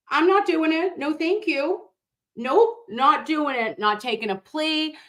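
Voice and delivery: high, firm no-way refusal; emphatic refusal. Real, not acted.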